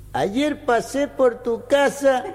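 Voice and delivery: high, slow declaimed Cordoban verse; folk verse recitation. Acted. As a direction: declaim it like a comic folk couplet at a peña. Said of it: "una relación así cordobesa".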